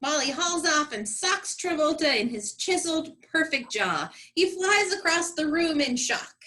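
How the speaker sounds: normal talking voice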